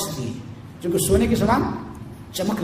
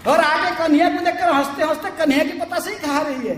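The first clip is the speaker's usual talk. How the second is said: high-pitched, amazed mock complaint; acted exasperated exclamation; 9 semitones higher than usual. Mother exclaims over child eating.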